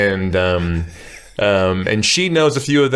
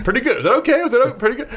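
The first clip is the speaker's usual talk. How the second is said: high, giggly check on how the dialect sounded; laughing self-consciously; 13 semitones higher, much faster than usual. Real, not acted. To her co-host after attempting Austrian dialect, laughing high. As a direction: laugh and ask much higher if that was okay.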